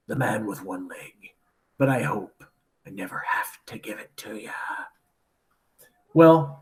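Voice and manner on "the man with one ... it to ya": low hushed whisper, dropping to breath; secretive whisper. Retold or imitated. Pirate whispering a secret warning to young Jim, hushed. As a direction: confide it like a dark secret.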